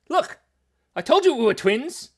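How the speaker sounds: loud, high, gleeful; delighted shout to a twin viewer; excited. Real, not acted. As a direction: crow about being right.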